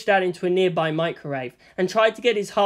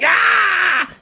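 startled shout; 13 semitones higher, 8 dB louder than usual. A narrator's reading. Startled cry in story.

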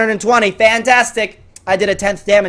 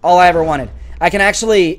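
usual speaking voice

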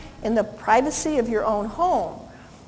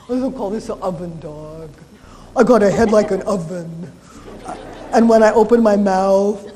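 sweet, cooing talk to a big dog; mastiff baby talk; 5 semitones lower, 4 dB louder than usual. Retold or imitated. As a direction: coo at the mastiff like it's a baby. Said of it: "you gotta talk like this when you talk to a mastiff".

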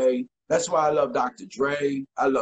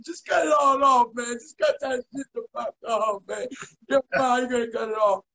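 retold angry shout; 10 semitones higher than usual. To his barber, fed up with his hair, a high angry yell. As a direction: bark at the barber to cut it all off. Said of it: "I got pissed off".

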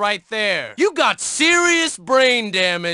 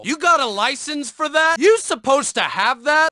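usual speaking voice

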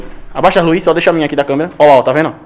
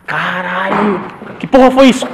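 startled; 3 semitones higher, slower than usual. Real, not acted. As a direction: jump at a sudden noise. Sudden scare in corridor.

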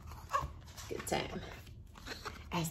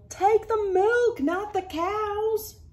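higher, louder, drawn out slowly; high urgent call; 13 semitones higher, 5 dB louder, slower than usual. Acted. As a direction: call after him urgently. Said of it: "yelled Country Carl".

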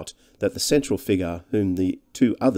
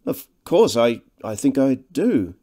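hesitant, forced friendliness; awkwardly polite, pitched up; laboured cordiality; 4 semitones higher, 4 dB louder than usual. A narrator's reading. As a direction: stumble into a polite recognition. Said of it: "rather laboured cordiality".

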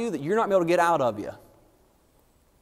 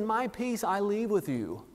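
near-whispered, slow, much softer; hushed Jesus quote; 9 dB quieter, slower than usual. Retold or imitated. Preacher softly voicing Jesus before the cross, hushed. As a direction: lay it down softly like a parting gift. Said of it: "he said".

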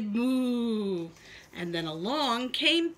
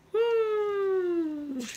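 owl hoot; 7 semitones higher than usual. Acted. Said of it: "the owl said".

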